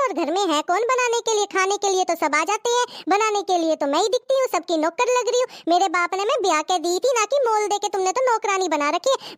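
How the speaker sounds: ordinary talk, nothing marked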